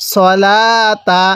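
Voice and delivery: সলাতা very slow with long লা; drawn-out recitation. Shown as a demonstration. Teacher models the word for students, slow recitation. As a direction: hold লা in সলাতা, drop to a short তা.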